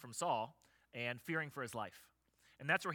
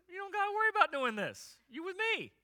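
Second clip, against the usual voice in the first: a much higher, cocky voice for God; 4 dB louder than usual. Retold or imitated. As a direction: answer like the big friend who has your back. God's reply, echoing [name].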